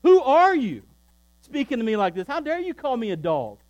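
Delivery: an insulted mother rebuking Jesus, loud and indignant; indignant outrage. Pretend. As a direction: bristle with hurt pride, nearly shouting. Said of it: "She does not say".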